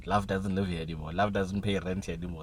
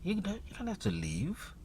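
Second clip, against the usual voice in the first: much higher, soft, slow coaxing; cooing blankets; 10 semitones higher, 5 dB quieter, slower than usual. Pretend. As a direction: cajole a sleeper to stay. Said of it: "the blankets are like".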